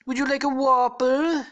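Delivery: high, very slow, drawn-out taunt; hammed-up presidential impression, loud and stretched; Donald Trump. Trump taunts the bagged captive, hammy impression. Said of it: "then Trump is like".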